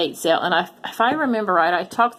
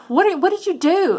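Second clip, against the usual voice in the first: shocked; 10 semitones higher than usual. Retold or imitated. Husband carries pumpkin back in.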